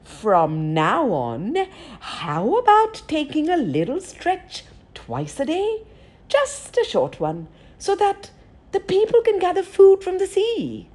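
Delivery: coaxing, sly trickster voice; persuasive trickster voice. Acted. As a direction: sweet-talk him like a trickster. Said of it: "with his most persuasive trickster voice".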